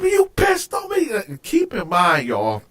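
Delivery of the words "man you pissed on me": high-pitched indignant yell; outraged accusation. Retold or imitated. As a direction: re-enact yelling at the cellmate. Retold angry accusation.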